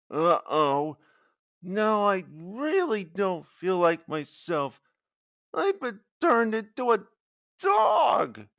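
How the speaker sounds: high, rising worried dog voice; little dog voice. Acted. Newly turned Pomeranian frets, high and rising. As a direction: talk like a tiny dog getting panicky.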